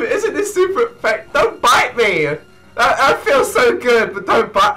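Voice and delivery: ordinary delivery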